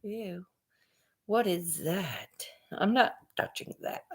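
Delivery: quiet, lightly voiced squeamish plants; disgusted plants. Plants balking at garage-smelling rope, mock disgust. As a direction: recoil softly, as fussy plants might. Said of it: "my plants would be like".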